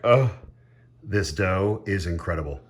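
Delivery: usual speaking voice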